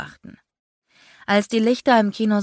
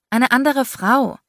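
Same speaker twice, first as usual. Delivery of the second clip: annoyed; 7 semitones higher, 5 dB louder than usual. A narrator's reading. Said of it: "Ich verdrehte genervt die Augen".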